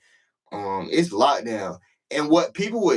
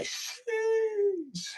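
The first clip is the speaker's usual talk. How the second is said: squealed, drawn-out falsetto sheesh; high-pitched sheesh; 6 dB quieter than usual. Retold or imitated. Inmate walking off after a close moment, squealed sheesh. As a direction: stretch it out in a tiny high voice. Retold reaction walking away.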